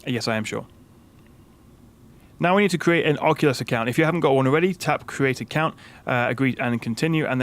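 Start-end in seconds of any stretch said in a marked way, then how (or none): none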